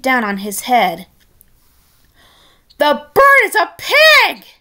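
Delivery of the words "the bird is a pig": high, loud alarmed cry; Red shouting in alarm at the pig. Acted. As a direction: shout it out in shock. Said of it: "Red shouted in alarm".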